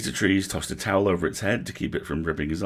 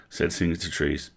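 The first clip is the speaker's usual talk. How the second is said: ordinary delivery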